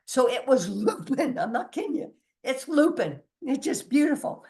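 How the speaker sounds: giggling with delight, pitch jumping up; bubbling laughter of real joy; laughing through it. Real, not acted. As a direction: laugh while you tell it, thrilled.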